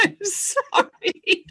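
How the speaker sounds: slow, giggling sorry; laughing apology. Real, not acted. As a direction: apologise through real laughter. Laughing after tech mishap.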